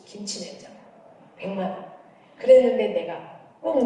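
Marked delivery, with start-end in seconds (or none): 0.1-1.9 whispered aside. Retold or imitated. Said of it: "더 내 마음에 빨리 와닿는 건".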